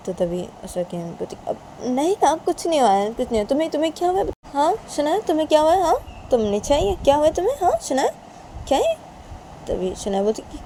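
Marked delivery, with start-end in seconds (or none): 1.5-8.9 high-pitched denial turning to concern; fussing mother, raised voice; mother deflecting. Acted. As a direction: brush it off, then question your daughter higher.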